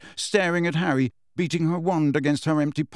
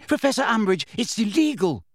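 Hermione protesting; 8 semitones higher than usual. A narrator's reading.

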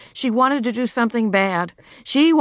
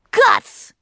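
put-on high, loud child's yell; loud high-pitched shout; 8 dB louder than usual. A narrator's reading. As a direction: yell the bad word to shock them. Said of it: "she yelled".

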